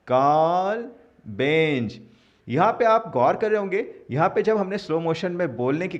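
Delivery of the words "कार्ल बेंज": syllable by syllable, slow and louder; slow motion. Shown as a demonstration. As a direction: stretch each word out slowly. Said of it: "वो भी slow motion में".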